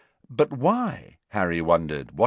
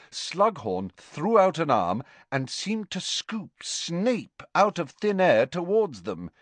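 ordinary delivery

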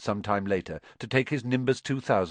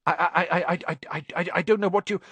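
high, loud nervous stammer, repeating every sound; a timid professor tripping over each word; stuttering Quirrell; 8 semitones higher, 4 dB louder, faster than usual. Acted. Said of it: "he was stuttering worse than ever".

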